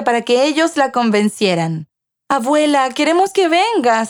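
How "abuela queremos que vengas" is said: put-on higher-pitched childlike plea; pleading children. A narrator's reading. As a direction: beg grandma lightly, as a child would. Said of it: "suplicaban los niños". (súplica infantil, voz más aguda)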